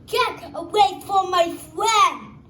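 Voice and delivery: loud, high, brave cry to protect a friend; brave shout. Acted. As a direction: yell it out to defend your friend. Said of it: "he wanted to say".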